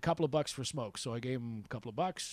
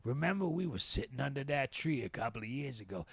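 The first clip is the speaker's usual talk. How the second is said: Bay. Retold or imitated. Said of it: "and he just goes".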